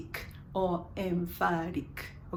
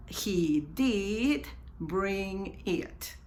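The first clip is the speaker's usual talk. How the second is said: very slow, spaced, long DID; emphatic auxiliary DID; 2 semitones lower, 4 dB quieter, much slower than usual. Shown as a demonstration. Teacher repeats for learners, very slow with stretched DID. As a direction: spell it out slowly, holding DID. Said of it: "usamos su auxiliar".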